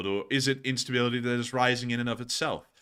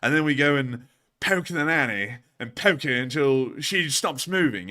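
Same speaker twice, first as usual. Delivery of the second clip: high, louder posh Eton schoolboy; posh child accent; 5 semitones higher, 4 dB louder than usual. Retold or imitated. Eton child proposing a cruel game, high posh British accent. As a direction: pitch up into an eager posh British pupil. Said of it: "that accent is something".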